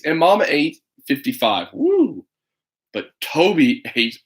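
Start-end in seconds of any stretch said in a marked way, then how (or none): 1.7-2.2 high cheer of amazement; excited whoop. Acted. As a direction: whoop at how many they ate. Cheering pancake count.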